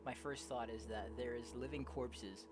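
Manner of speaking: low, soft, measured reading; story narration. Acted. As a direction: read the tale quietly and evenly. Reads game story aloud.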